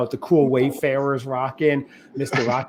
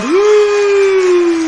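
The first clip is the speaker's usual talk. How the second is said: excited cheer; 8 dB louder than usual. Real, not acted. Celebrating the 150th episode.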